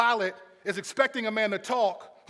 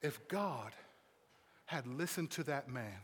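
hushed preaching; 10 semitones lower, 15 dB quieter than usual. Acted. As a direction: drop to a grave near-murmur with long pauses.

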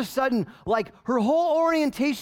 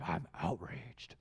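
low, soft, slow sarcastic "I'm outraged" with weight on outraged; mock outrage; 16 dB quieter, slower than usual. Preacher mocking social media users, drawn-out hushed scorn.

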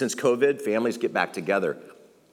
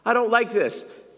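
whiny complainer; 11 semitones higher than usual. Acted. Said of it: "voicing a complaining listener".

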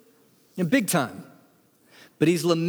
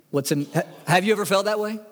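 normal talking voice

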